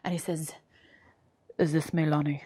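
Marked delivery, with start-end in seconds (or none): 1.6-2.4 low, hushed Russian caller; mock-mysterious Russian accent, soft and low; thick Russian accent. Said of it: "this thick, like, Russian accent".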